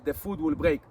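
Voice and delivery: normal talking voice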